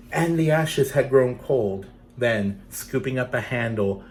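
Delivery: gentle storytelling read; story narration. A narrator's reading. Reading a legend to children, gentle storytelling.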